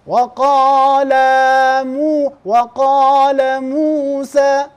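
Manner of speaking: وقال loud and stretched long; wrong elongation demo. Shown as a demonstration. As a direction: drag out وقال as weqaala. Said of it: "درجة واحدة".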